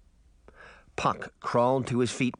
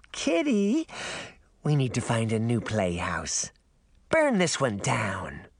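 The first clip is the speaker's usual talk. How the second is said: put-on creepy little girl. A narrator's reading. Creepy girl orders monster.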